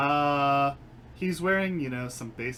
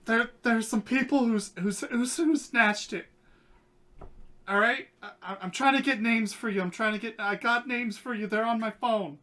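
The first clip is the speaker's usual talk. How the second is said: high, loud, frantic begging; panicked pleading; 11 semitones higher, 6 dB louder than usual. Acted. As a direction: plead fast, scared for his life. Terrified NPC pleading at gunpoint.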